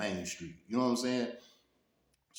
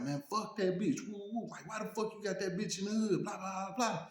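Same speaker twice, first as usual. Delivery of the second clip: high, sneering gang comeback; retold hostile retort; 12 semitones higher than usual. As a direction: throw the insult back, hammed up. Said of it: "They like".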